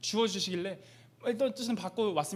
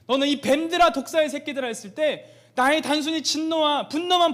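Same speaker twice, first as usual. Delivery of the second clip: much louder, higher, pained sermon cry; full-force preaching; 5 semitones higher, 8 dB louder than usual. Acted. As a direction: thunder God's grief over the leaders. God's lament preached at full force. (하나님의 아픔을 외치는 설교)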